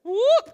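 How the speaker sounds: high, loud surprised whoop; sudden loud whoop. Retold or imitated. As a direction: yelp as he tips over. Tim starts rolling down bank.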